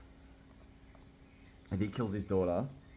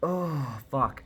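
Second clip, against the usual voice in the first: upset sigh-out curse, higher and louder; getting emotional; 6 semitones higher, 5 dB louder than usual. Real, not acted. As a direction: let real upset burst out on the curse. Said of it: "I'm getting emotional thinking about".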